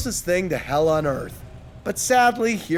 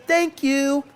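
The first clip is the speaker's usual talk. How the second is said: light, higher sing-out; called over shoulder; 7 semitones higher than usual. A narrator's reading. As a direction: toss it back as a passing call. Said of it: "called over his shoulder".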